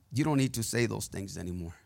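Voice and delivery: low, soft, quick reassurance; hushed, kindly low voice; gentle correction. Acted. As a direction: speak as God softly correcting someone.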